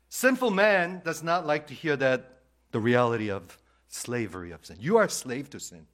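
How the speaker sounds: loud, emphatic pulpit declaration; loud sermon proclamation; full-force preaching. Acted. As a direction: drive the hard truth home.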